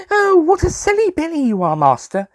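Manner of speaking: high, mocking young girl's voice; teasing girl voice. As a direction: tease him like a cheeky little girl. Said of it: "Susie said".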